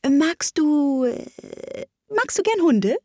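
high, hesitant, then eager question; falter, then brightly change the subject; eagerly fumbling. A narrator's reading. Alice seeks another subject, fumbling and over-eager. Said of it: "eifrig bemüht".

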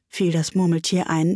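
ordinary delivery

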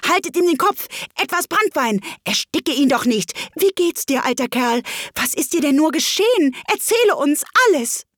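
high, loud jumbled animal voices; confused voices. Acted. Animals fuss over Wabbel, high and loud. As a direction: crowd round him, high and eager. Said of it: "verworrene Stimmen".

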